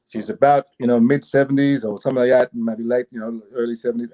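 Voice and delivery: ordinary talk, nothing marked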